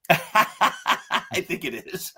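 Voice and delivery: slow, drawn-out words through a laugh. Real, not acted. Runner tells the host, slowly, through a laugh. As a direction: laugh it off, giving up on the dream.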